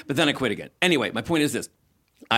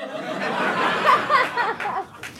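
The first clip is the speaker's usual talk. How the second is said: airy high laugh; high breathy laugh. Real, not acted. Comic laughing at her own joke.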